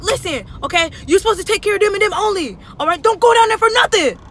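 high loud scolding, fast; imitated angry yelling. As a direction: bark it like an angry boss. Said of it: "You up here screaming".

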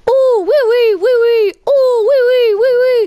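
loud, high, gushing oui oui; overjoyed French teacher cries oui oui; ecstatic oui oui. Acted. Teacher praises pizza answer, loud excited oui oui.

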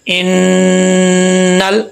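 "इन्नल" with the doubled न held in a long nasal hum; ghunna demo. Shown as a demonstration. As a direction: hum through the न of "इन्नल" very slowly. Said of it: "तो इस पे गुन्ना करेंगे". ("इन्नल" में नून पर लंबा गुन्ना करें)

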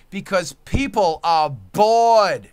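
slow loud rant line; loud slow declaration. Real, not acted. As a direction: hammer home that people are bored. Rant building.

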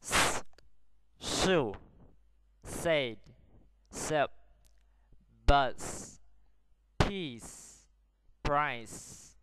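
crisp, drawn-out hissing S in "bus", "peace", "price"; word-list demo, "S" held as a long voiceless hiss; modelling the S sound. Shown as a demonstration. Teacher models the first column for pupils, clear S in "shoe, said, sip". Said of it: "listen to me".

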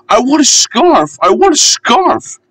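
very high chanted puffing complaint; puffing little engine. A narrator's reading. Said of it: "he would puff".

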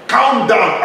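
flings back the words calm down, loud and high; indignant shout. Real, not acted. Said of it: "You have this holy anger".

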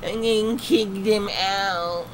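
high, slow whiny mock of the owner. Mocking the server owner.

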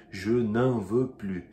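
Je n'en veux plus, slow and loud, no S; slow and loud, S silent. Shown as a demonstration. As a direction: say it negative with plus ending on the vowel. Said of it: "com sentido de negativa".

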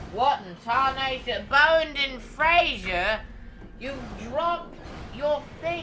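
high, loud hillbilly twang; folksy accent. Acted. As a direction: slip into a hammed-up country accent. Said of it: "bad at doing the accents".

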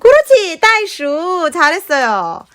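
아주 높고 크게 감탄하며; 아이를 치켜세우듯 들뜬 목소리; 신나는 칭찬. Acted. Excited high praise to toddler. (아이에게 크게 칭찬하는 말투)

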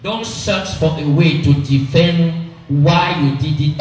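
usual speaking voice

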